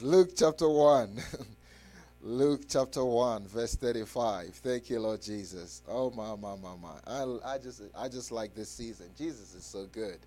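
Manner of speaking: ordinary talk, nothing marked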